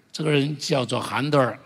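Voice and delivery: ordinary talk, nothing marked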